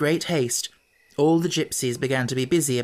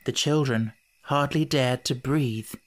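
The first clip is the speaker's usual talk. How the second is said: ordinary delivery, nothing marked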